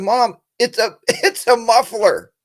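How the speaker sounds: disbelieving son, laughing at mom; laughing exasperation. Retold or imitated. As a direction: son telling mom it's only a muffler, laughing. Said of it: "I said".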